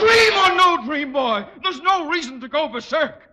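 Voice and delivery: panicked high shouting; frightened man shouting and pleading; hysterical. Acted. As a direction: babble in terror to calm the attacker. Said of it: "don't get hysterical".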